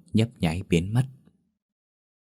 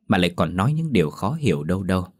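usual speaking voice

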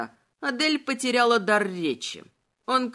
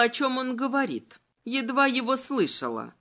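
usual speaking voice

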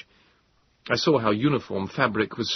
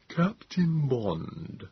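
very slow, softer alien voice; slow Morlock; 6 semitones higher, 4 dB quieter, much slower than usual. A narrator's reading. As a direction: draw it out, slow and soft, as the Morlock. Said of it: "the Morlock said slowly".

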